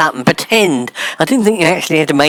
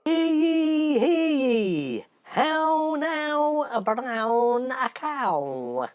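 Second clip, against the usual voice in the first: tiny high, drawn-out wail; a timid, very soft attempt at proclaiming; feeble town cry; 7 semitones higher, 11 dB quieter, much slower than usual. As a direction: squeak out the cry far too softly. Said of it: "Can't you try harder than that?".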